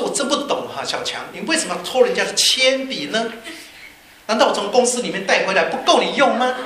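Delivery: mock-stern parental outburst; exasperated father. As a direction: play a fed-up dad telling off his son. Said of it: "父亲回家就很气啊".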